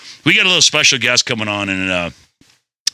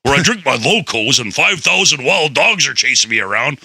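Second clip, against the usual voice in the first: Jesse Ventura impression; 4 semitones higher than usual. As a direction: bark it like a wrestler-governor robot. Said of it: "He goes".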